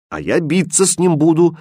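determined challenge; fierce resolve. A narrator's reading. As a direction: declare it boldly, spoiling for a fight. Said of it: "нахмурил грозно брови".

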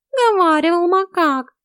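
high, loud, slow cat voice; drawn-out, high-pitched jibe; mocking cat. A narrator's reading. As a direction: cut in with a cat's mockery.

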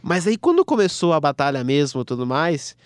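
ordinary talk

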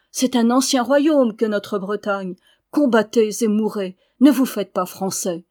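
building from firm to loud ringing roll call of cities; rousing battle call. Acted. Suite de l'appel, très fort. (appel guerrier de plus en plus fort)

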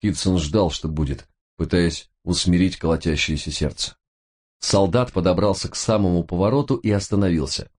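usual speaking voice

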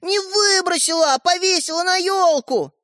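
very high, despairing correction; desperate protest. A narrator's reading. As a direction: insist on it in high-pitched despair. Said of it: "задыхаясь от отчаяния". (с отчаянием, высоким голосом)

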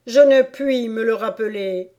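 troubled Hatter owning up; pleading Hatter. Acted. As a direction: confess it to the court. Hatter confesses to the court.

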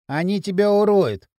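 usual speaking voice

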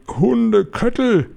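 high, drawn-out cry; imagined scream. A narrator's reading. Witch sounding the alarm through the hall, high drawn-out cry. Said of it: "würde der Schrei".

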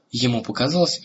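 ordinary delivery

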